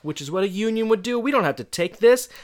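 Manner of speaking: high, loud mock rallying cry; fired-up strike leader. Student rallying classmates to strike, fired-up mock defiance. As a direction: imitate a defiant kid rousing the troops.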